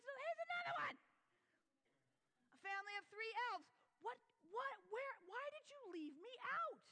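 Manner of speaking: put-on high, loud upset young boy; upset kid. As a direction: whine it like a hurt child. Boy upset, left out of ornaments.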